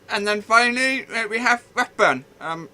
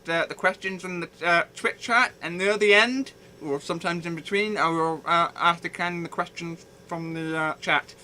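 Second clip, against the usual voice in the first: normal talking voice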